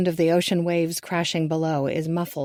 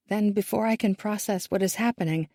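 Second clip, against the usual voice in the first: plain reading voice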